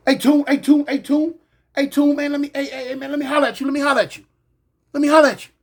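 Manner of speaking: high, loud, insistent calling of a name; urgent calling out. Retold or imitated. Inmate rushing back calls out to the narrator, loud and pleading.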